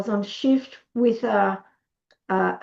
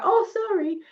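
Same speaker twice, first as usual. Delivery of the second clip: high, sheepish mock apology; 10 semitones higher than usual. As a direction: back down meekly in a high voice. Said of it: "A soft bully will go".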